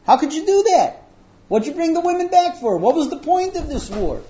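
high, loud indignant scolding; acted upset outburst. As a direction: demand answers like an outraged leader. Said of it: "and he gets upset".

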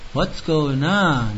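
dazed little "What's going on?" drawn out; simple son's bewildered question. Acted. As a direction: ask it slowly, like a child who doesn't understand. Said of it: "He just looks and says".

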